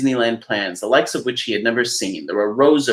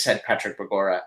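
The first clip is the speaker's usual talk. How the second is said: plain reading voice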